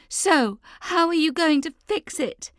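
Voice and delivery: high, loud demanding yell. Acted. Leah yells at mum to fix the crisis. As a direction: yell the question at her mother. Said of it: "Leah's yelling".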